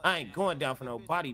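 ordinary delivery

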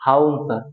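হাউন স্যার said with no r sound, haun sar; mock-British হাউন স্যার, drop the r in Harun; British-style drop of r. Shown as a demonstration. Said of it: "ব্রিটিশদের মতো উচ্চারণ করে বলবো".

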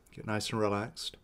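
soft, unhurried meditation guide with long pauses; guided meditation. Real, not acted. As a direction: guide them gently inward, leaving space between phrases. Leads a releasing meditation.

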